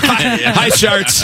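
lightly voiced, high-pitched show contestant; mock pitch contestant. Host playing contestant greeting the investors, mock pitch. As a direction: raise the voice into a hammed-up product pitch.

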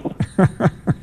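short airy unvoiced chuckle; breathy laugh. Real, not acted. Host cracking up at the caller's sign-off, airy chuckle. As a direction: let a helpless breathy laugh slip out. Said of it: "I don't know why I'm laughing".

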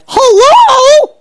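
very high, drawn-out squeal; hammed-up shriek of discovery; mock shock. Acting out shocked discovery of label.

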